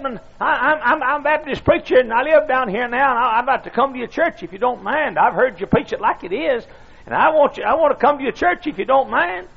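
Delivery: put-on high, stammering old preacher; squeaky old voice. Old preacher asking to join the church, squeaky. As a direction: plead in an old man's squeak. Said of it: "In a squeaky old voice".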